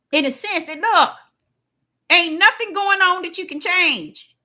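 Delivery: high, loud commanding declaration; God rebuking Deborah, loud and lifted; preached voice of God. Retold or imitated. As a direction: proclaim it as the Lord. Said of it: "He have to tell me".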